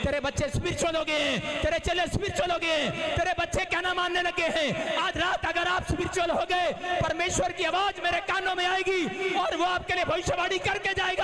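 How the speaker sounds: high, loud preacher's proclamation; loud high revival preaching; full-force preaching. Acted. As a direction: proclaim it over the crowd, shouting.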